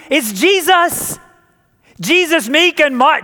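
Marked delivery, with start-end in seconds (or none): 0.1-1.2 slow, raised reveal of the name; pastor's punchline reveal, slow and raised; dramatic reveal. Acted. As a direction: land the big answer slowly after a drum roll. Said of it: "drum roll please".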